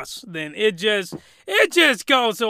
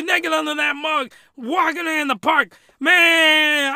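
higher and louder, exasperated; outraged rant; 7 semitones higher, 5 dB louder than usual. Real, not acted. Ranting to viewers about the leash, loud outrage. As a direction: get the fruit out, fed-up outrage.

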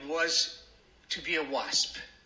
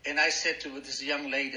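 ordinary delivery

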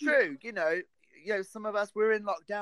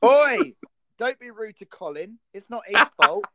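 sudden high, loud telling-off; mock scolding; 6 semitones higher, 10 dB louder than usual. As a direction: scold him in jest.

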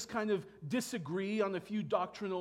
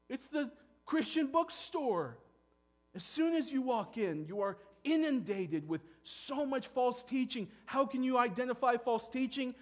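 higher, louder impassioned preaching; preaching at full force; 7 semitones higher, 6 dB louder than usual. Real, not acted. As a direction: press the congregation hard.

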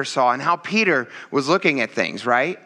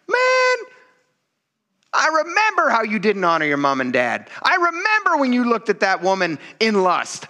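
mock scolding God; 9 semitones higher than usual. God voiced scolding the man.

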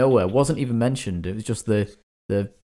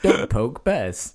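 mock rule; 3 semitones higher, much slower than usual. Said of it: "Just like the Scout's Guidebook".

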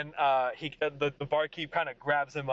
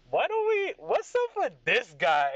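put-on drunken heckle, leaping high then drawn out; mock a rival like a rowdy drunk; Craig; 9 semitones higher, 5 dB louder than usual. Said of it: "And he goes".

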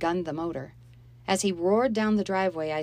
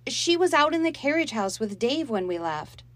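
put-on higher, louder girl pleading her case; defensive girl; 8 semitones higher, 5 dB louder than usual. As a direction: explain yourself to mother. Molly defending herself to Mom.

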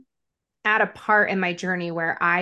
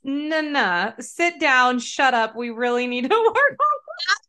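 higher-pitched, laughing mock reprimand; bossy mock scolding; 6 semitones higher than usual. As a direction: play the bossy body telling her off, breaking into laughter. Acting out the body scolding her.

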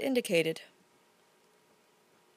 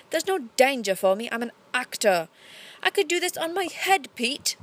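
haughty; 2 semitones higher, 3 dB louder than usual. A narrator's reading. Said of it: "she went haughty at once".

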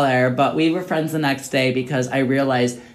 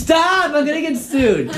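shout; 10 semitones higher, slower than usual. Real, not acted. Stopping friend naming someone.

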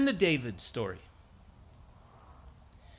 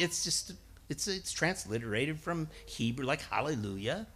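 ordinary talk

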